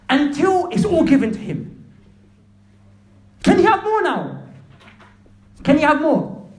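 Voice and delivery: loud, high-pitched sermon delivery; ringing, heated exclamations; impassioned preaching. Real, not acted. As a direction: preach at full force, amazed. Preaches at full force.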